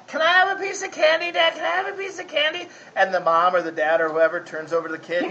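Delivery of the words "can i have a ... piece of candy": put-on high pleading kid voice; hammed-up child asking for candy; begging child. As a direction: whine like a kid wanting candy.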